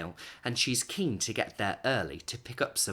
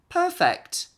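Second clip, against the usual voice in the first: high, loud thrilled exclamation; delighted; 9 dB louder than usual. Acted. Missus Rainbow admiring her green hair, thrilled. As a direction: squeal it with pure delight. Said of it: "thinks Missus Rainbow".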